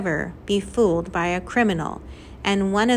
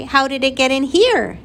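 raised, louder indignant shout; 4 semitones higher, 5 dB louder than usual. A narrator's reading. Flora's mother corners the squirrel's owner, shouted. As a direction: shout the question out. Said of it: "shouted Flora's mother".

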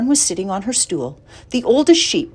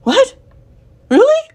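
high, loud squeals of surprise; amazed, high-pitched yelps; astonished cry; 8 dB louder than usual. Acted. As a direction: cry out in sudden hope. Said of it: "cried Wilbur".

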